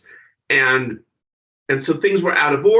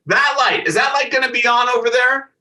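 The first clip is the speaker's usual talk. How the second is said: higher, louder demanding diva; Joan Rivers demanding; 9 semitones higher, 3 dB louder than usual. Retold or imitated. A star quizzing stage crew, higher and pushy. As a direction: press the stage crew about the light. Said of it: "she says".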